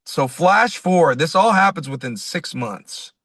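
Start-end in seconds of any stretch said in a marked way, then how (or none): none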